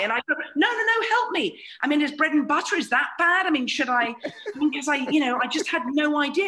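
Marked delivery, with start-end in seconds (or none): 0.6-4.1 high, loud sarcastic pleading; mock panic. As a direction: beg for help in pretend alarm.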